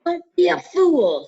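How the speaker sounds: high, loud, slow snarl. A narrator's reading. Voldemort threatening Harry, high loud slow snarl. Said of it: "snarled the voice".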